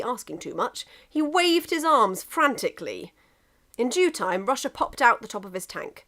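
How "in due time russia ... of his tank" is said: plain reading voice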